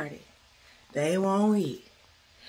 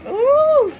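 excited squeal; 14 dB louder than usual. Real, not acted. Reacting to the kids.

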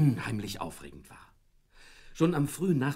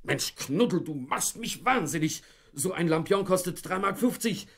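higher and louder as the scolding builds; blustering rant; 10 semitones higher, 4 dB louder than usual. Acted. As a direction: bluster at the dog over a broken lantern. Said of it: "Aber der polterte trotzdem los".

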